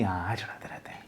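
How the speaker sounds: whispered, unbothered; casual shrug-off. Shown as a demonstration. Teacher shows a child who finds teasing normal, whispered and offhand. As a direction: toss it off like it's nothing. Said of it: "बहुत normal है".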